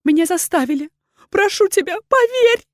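frantic, high woman's voice; desperate pleading. A narrator's reading. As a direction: beg to be believed, voice rising. Accused woman begs to be believed.

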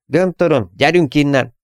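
high, urgent shout to get out. A narrator's reading. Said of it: "kiabálta Rascal".

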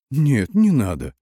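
high, slow pleading; plaintive cry. A narrator's reading. Lieutenant begs not to be shot, plaintively. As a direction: beg piteously for mercy. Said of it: "жалобно выкрикнул сопливый Летёха".

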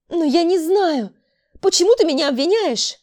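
high, loud wail of innocence; desperate protest. A narrator's reading. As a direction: cry out against being blamed. Accused girl cries out protest. (отчаянный протест)

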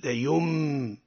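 "ليم" closing on a long hummed m; pure meem. Shown as a demonstration. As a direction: hold the m of "ليم" in place of the n. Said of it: "تقرأ ميما خالصة". (نطق "ليم" بميم خالصة ممدودة)

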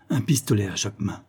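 plain reading voice

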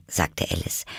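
ordinary delivery

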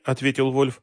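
usual speaking voice

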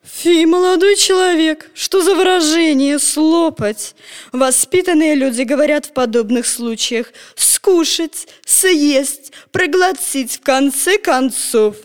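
slow, fussy, prim grandmother; prim old lady. A narrator's reading. Grandmother lectures Petya on manners, prim and slow. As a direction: correct his manners haughtily.

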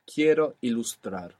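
ordinary talk, nothing marked

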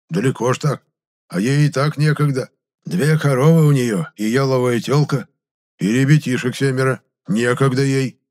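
a hint of an old man explaining insistently; lightly voiced country grandad, animated; lightly voiced old villager.

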